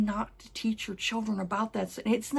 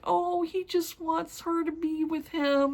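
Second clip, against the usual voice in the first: high, slow tender gush; tender aww; 9 semitones higher, slower than usual. Retold or imitated. Gushing over a sweet character.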